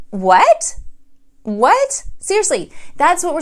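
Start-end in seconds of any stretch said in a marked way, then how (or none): 0.1-0.8 louder disbelieving "What?"; shocked what. Retold or imitated. Sees blue Pinto, not Ferrari. 1.4-2.0 "What?" pitched higher in disbelief; higher shocked what. Retold or imitated. Sees blue Pinto, not Ferrari.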